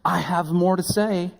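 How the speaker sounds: bright eager high tone. Retold or imitated. Doctor to pastor, eager to keep testifying. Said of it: "she said".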